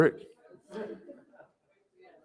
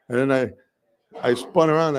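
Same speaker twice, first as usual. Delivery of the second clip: ordinary delivery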